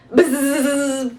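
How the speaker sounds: a long buzzing zzz like a swarm; buzzing bee noise. A narrator's reading. Narrator buzzes the swarm of bees chasing Maisie. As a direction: buzz it out like angry bees.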